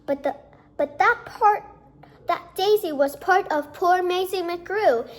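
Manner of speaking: ordinary delivery, nothing marked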